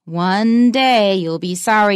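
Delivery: slow, weighty stress on "One day"; slow, emphatic threat with "One day" hit hard; strong threat. Shown as a demonstration. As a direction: make it sound like a warning. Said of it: "You can hear the difference".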